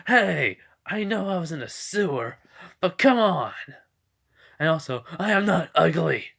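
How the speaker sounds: high, loud, whiny comeback; hammed-up wounded indignation; offended protest. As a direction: protest like an insulted cartoon villain. Insulted villain protests.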